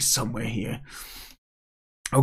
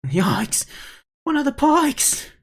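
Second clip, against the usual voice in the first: high, louder cry of alarm; startled yelp; 4 dB louder, slower than usual. Acted. Small character spotting a dreaded pike, startled yelp. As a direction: yelp it high, drawing out the end.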